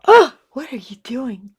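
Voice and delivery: mock viewer yelling at the painter; imitating a viewer's scream. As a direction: shout like a horrified viewer. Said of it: "you wanna just scream and go".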